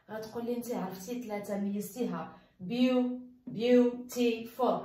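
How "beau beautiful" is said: "beautiful" very slowly, broken into beau, ti, ful; split into syllables. Shown as a demonstration. Teacher dividing "beautiful" for students to count, slowly syllable by syllable. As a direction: sound out "beautiful" with a gap after each syllable. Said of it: "ملي قسمتها ها هي one".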